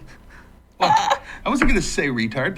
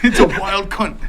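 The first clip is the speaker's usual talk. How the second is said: higher mock Australian, amused; Australian accent; 4 semitones higher than usual. Joker spotting a wild creature, mock Australian accent. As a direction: play the excited Australian animal spotter. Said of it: "Just say it in an Australian accent".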